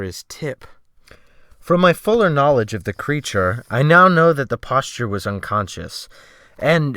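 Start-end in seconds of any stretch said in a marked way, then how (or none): none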